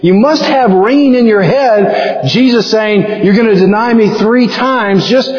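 urging Peter. Retold or imitated. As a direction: urge him to shut up and leave. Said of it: "you're saying".

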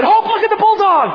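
re-enacted excited cry, very high and loud; excited dad. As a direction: call out high to get a child looking. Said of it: "She noticed me getting excited".

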